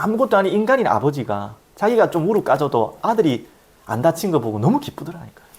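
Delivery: usual speaking voice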